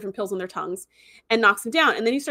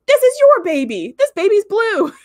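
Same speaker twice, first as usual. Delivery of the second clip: loud, high mock outrage; hammed-up indignant shout; imitated accusation; 11 semitones higher, 8 dB louder, slower than usual. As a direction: act out a father's outburst, pushed up high. Father confronts cult leader, retold.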